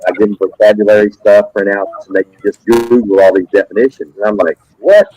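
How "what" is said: sharp high rising what, much louder; a loud high disbelieving what; shocked what. Retold or imitated. Teacher reacting to kids googling definitions, shocked loud what.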